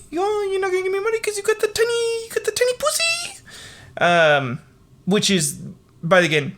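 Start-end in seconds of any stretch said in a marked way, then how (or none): 0.1-3.4 shrill falsetto mockery, much higher; squeaky mock taunt. [name] mocking [name] over money, squeaky high taunt. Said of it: "He was like".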